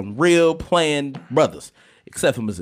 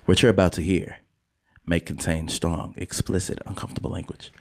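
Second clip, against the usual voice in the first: low, hushed, measured announcer delivery; formal disclaimer read; 9 semitones lower, 4 dB quieter than usual. Acted. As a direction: read it like a solemn viewer-discretion warning. Reads show's disclaimer.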